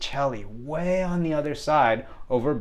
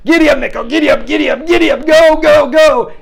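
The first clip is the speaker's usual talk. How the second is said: put-on high shouted kid voice; jockey urging; 12 dB louder than usual. Boy riding his friend's back, frantic urging shouts. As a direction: yell it like spurring on a horse. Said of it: "he's, like, riding me".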